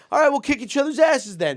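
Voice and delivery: high, loud, eager put-on voice; eager mock bravado. Young guys agreeing to brawl, high mock bravado. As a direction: pitch it way up, cartoonishly game.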